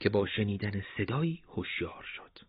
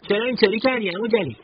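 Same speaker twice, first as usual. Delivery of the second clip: put-on amused young fighter; 14 semitones higher, 8 dB louder, faster than usual. Behnam amused at shirtless Jalil.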